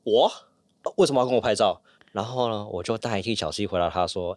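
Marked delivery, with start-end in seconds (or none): 0.0-1.8 startled stranger. Retold or imitated. Stranger asked for a photo by mistake, startled and puzzled. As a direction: mimic a bystander caught off guard. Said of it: "很惊恐的看着我说".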